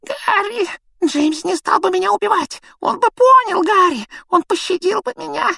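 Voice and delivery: very high, whimpering squeal; Pettigrew pleading. A narrator's reading. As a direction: beg tearfully in a tiny high voice. Pettigrew begging for his life. (тонкий плаксивый умоляющий голос)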